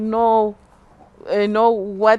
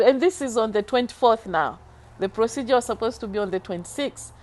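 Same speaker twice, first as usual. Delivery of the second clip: ordinary delivery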